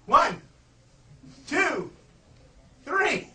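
rising dramatic count; building countdown. Pretend. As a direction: count it up like a big reveal. Counting down the flea trick.

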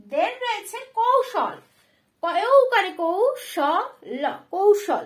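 teacher's classroom announcement; announcing loud. Shown as a demonstration. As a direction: call the new word কৌশল out to the class. Teacher announcing a new word.

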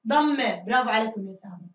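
ordinary talk

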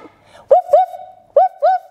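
high, loud yappy puppy woofs; puppy barks. Pretend. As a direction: bark like a playful little puppy. Puppy dog pose barks.

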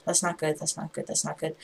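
very soft worried repeats; worried muttering. Real, not acted. Player out of arrows to himself, worried muttering. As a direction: mutter it under your breath.

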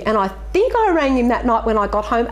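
ordinary talk, nothing marked

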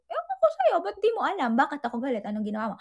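hammed-up jealous outburst that cools off; mock sulky snap. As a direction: play the sulking girlfriend who won't explain. Jealous partner snaps back sulkily.

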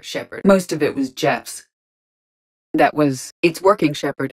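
ordinary talk

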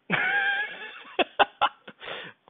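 high-pitched burst of laughter. Real, not acted. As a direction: crack up in a long, high laugh. Cracks up at his own story.

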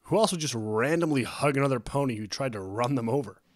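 loud, cheerful teasing; upbeat, lively question; happy and sweet. A narrator's reading. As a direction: tease a friend gladly. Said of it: "His tone was unusually happy and sweet".

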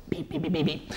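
soft, quick run of beeps; imitated beeping. Reviewer signing off the robot film, soft imitated beeps. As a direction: mimic a little robot softly.

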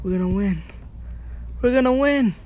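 building from excited to a high shriek; shrieking jackpot winner. Real, not acted. Winning, voice rising each time.

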